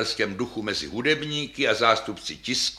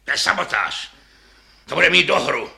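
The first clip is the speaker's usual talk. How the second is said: raised, louder conductor's voice; angry scolding; 4 semitones higher, 6 dB louder than usual. A narrator's reading. Conductor accusing players of sabotage, angry scolding. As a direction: cut them off with a sharp reprimand.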